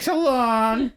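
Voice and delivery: mock farewell. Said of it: "I'll be like".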